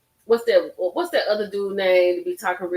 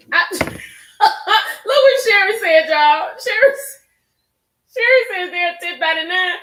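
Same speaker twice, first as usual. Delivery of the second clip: higher, louder, laughing through it; 9 semitones higher, 6 dB louder than usual. Real, not acted. Host laughing at viewer Sherry's comment, high laughter. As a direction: crack up reading the comment.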